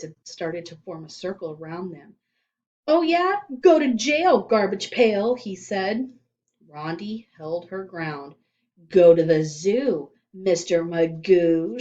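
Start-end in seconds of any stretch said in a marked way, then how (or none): 2.9-5.3 higher, louder jeering rhyme; shouted taunt. A narrator's reading. Terrence taunting Rondi in a circle of kids, loud. 8.9-11.8 slow, loud deliberate retort. A narrator's reading. Rondi standing her ground against Terrence, slow and loud. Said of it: "she retorted".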